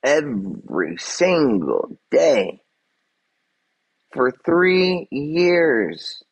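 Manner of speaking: each word dragged out in exasperation; drawn-out emphasis. Real, not acted. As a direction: stretch every word, very slow. Exasperated rant at haters.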